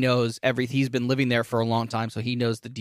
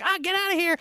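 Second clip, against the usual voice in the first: high scared shriek; Tess shooing him off; faster than usual. Retold or imitated. Tess yelling at the stranger she thinks is a kidnapper, high shriek. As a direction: shriek him away in panic. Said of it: "She's like".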